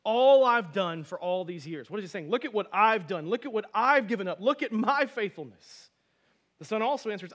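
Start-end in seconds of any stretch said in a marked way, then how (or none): 0.0-5.9 loud self-righteous protest; indignant older son. Retold or imitated. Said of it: "look at the son saying".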